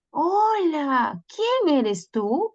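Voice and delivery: lightly voiced timid turtle; turtle asking. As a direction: ask shyly who is there. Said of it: "le dice".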